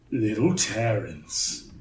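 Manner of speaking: low, slow menace; deep slow brute voice; menacing villain. Acted. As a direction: taunt the little one with contempt. Villain taunt at fight.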